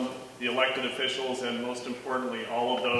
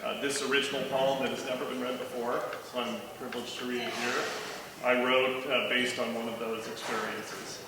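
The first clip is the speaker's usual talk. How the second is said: ordinary talk, nothing marked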